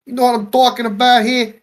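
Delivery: high, loud hammed-up mob boss; mock Jersey mobster. Mob father at his daughter's wedding, hammed-up Jersey accent. Put-on northern mobster bit.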